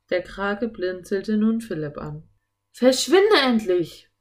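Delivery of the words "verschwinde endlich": loud, slow, high-pitched command; angry bellow. A narrator's reading. Philipp roaring at the octopus to leave, angry bellow. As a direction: yell it to drive the beast off. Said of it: "brüllte Philipp ihn an".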